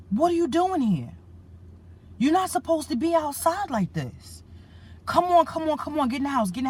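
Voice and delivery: high, pressing voice of worried strangers; urgent strangers. Retold or imitated. Strangers urging him inside, voiced.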